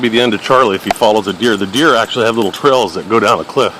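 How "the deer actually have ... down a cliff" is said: normal talking voice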